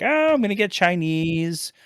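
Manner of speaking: light mock of wife asking about lunch; imitating wife. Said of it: "my wife's like".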